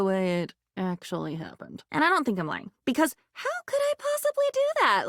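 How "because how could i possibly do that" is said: much higher, quick and indignant; incredulous protest. Acted. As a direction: squeak it out, offended at the idea. Indignant denial of lying.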